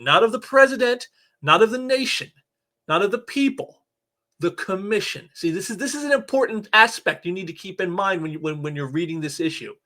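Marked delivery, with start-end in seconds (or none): 0.0-5.3 "president", "nation", "people" each set apart, higher, then "the commission"; emphatic contrast. Shown as a demonstration. Reviewer warning readers whom the oath serves, emphatic on "commission". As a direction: spell out the contrast, pausing before "the commission". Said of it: "show emphasis on the word".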